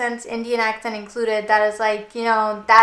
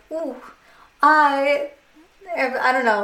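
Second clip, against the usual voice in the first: mock posh; 3 semitones higher, slower than usual. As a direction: put on a touch of snooty British arrogance. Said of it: "but posh is like".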